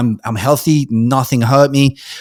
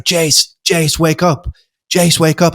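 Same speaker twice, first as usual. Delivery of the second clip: raised, higher urgent call to wake him; 4 semitones higher, slower than usual. Retold or imitated. Friend shaking him on the ground, calling out urgently. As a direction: call his name to rouse him.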